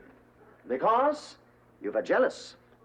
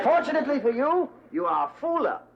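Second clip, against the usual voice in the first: put-on German accent; 7 semitones higher, faster than usual. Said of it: "talking with a German accent".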